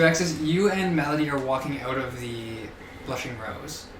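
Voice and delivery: ordinary delivery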